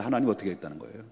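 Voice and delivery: usual speaking voice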